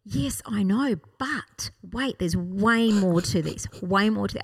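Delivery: ordinary talk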